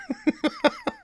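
high wheezy laugh. Real, not acted. Storyteller breaking up at his own teacher impression, laughing. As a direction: crack up helplessly.

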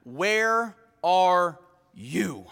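very slow, word by word; God calling Adam. Acted. The Lord calls Adam in the garden, slow and measured. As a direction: space out each word deliberately. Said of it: "the Lord called Adam".

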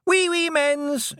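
put-on high, slow gleeful toddler squeal; drawn-out baby shout; toddler yelling. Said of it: "he yelled".

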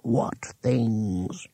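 soft, small voice from the back of the mind; small papery voice inside his head; tiny dry voice. Acted. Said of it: "A tiny, dry voice at the back of his brain".

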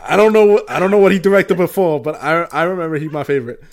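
loud, high, flustered defence; high-pitched outburst easing off at the end; flustered protest. Real, not acted. Teased about his favorite director.

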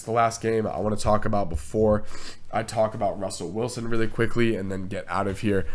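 ordinary delivery

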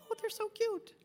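high-pitched adoring coo. Retold or imitated. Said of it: "it's heartwarming. We just go".

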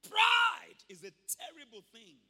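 loud, slow, high warning cry; preaching shout. Real, not acted. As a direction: thunder it from the pulpit. Preaching emphasis.

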